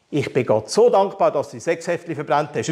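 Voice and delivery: ordinary talk, nothing marked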